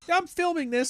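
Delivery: very high, prissy "I'm filming this"; mock squeaky filmer. Mocking a smug person who films confrontations, very high.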